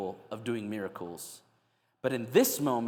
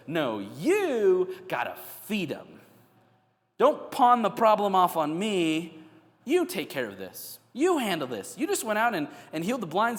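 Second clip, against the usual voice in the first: emphatic, higher-pitched rebuke; pushing back; 5 semitones higher, 5 dB louder than usual. Acted. Jesus refusing the disciples' problem, pushing back firmly. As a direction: throw it back at them, hitting "you". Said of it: "he's pushing back on the disrespect".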